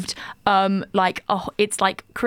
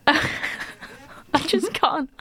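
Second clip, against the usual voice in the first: words squeezed out through high laughter; can't get it out for laughing; laughing helplessly; 7 semitones higher, much slower than usual. Real, not acted. Laughing at her friend's northern accent, barely able to speak.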